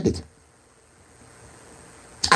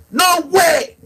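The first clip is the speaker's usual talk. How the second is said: defiant shout; 11 semitones higher than usual. Real, not acted. Defiant outburst.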